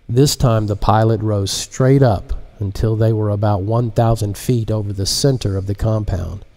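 ordinary delivery

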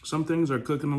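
talking with food in mouth; muffled through mid-chew bite; chewing while talking. Real, not acted. Seen 1: Lips closed, jaw working over potato tray after bite; clears by 4.4.

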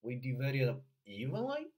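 "We divided up evenly" with a loud, separate, rising "evenly"; stress on EVENLY. Shown as a demonstration. Coach showing the most important word, stress on evenly. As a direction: punch "evenly" without linking it to "up". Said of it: "或强调".

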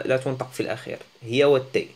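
normal talking voice

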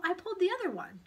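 put-on high, loud child's protest; defensive boy. A narrator's reading. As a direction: defend yourself to the teacher. Paul defends himself.

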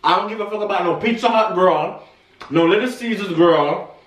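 eating while speaking; talking while chewing. Real, not acted. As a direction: mouth partly full of pizza. Seen 0: Right speaker bit pizza at -5, still holds slice while talking.

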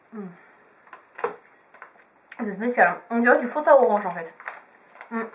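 talking around candy; chewing while talking. Real, not acted. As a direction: mouth partly full. Seen 2: Bean put in mouth at -4; chewing motions visible throughout.

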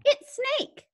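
high-pitched storybook mouse. Acted. Mouse tells the Gruffalo who is coming, high mouse voice. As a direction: pipe up as a little mouse. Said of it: "such an impressive mouth voice".

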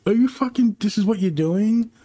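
high-pitched exasperated disbelief; incredulous. Retold or imitated. Viewer questioning the show's writers, incredulous. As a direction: pitch it up, appalled at the choice.

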